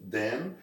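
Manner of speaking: "then" said with an e sound, "den". Shown as a demonstration. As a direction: voice "then" with a plain e. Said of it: "pronúncia de E mesmo".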